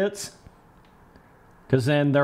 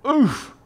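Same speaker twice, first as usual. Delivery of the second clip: high sharp oof; wincing oof; 11 semitones higher, 3 dB louder than usual. Real, not acted. Streamer wincing as Spence crashes on nothing. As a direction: react to a crash.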